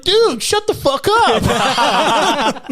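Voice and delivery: imitated child's yell, high and loud; shouting young kid. Retelling the kid on an open mic, high mock shout. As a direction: stretch it out as a slow, high kid's shout. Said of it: "nine to ten years old".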